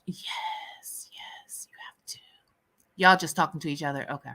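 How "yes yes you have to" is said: emphatic, very quiet whisper; hushed, voiceless urging. Real, not acted. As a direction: whisper it insistently to urge them on. Urging a viewer to watch.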